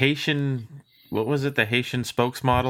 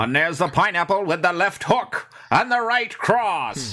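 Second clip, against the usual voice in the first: boxing commentator; 6 semitones higher than usual. Acted. Co-host turns beer tasting into a fight, boxing commentator. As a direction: call the punches like a ringside announcer. Mock boxing call of pineapple flavour.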